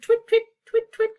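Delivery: very high bird chitter; starling twitter. Acted. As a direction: chirp like a starling. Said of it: "The starlings chittered".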